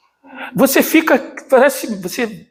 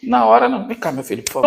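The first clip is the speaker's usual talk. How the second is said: usual speaking voice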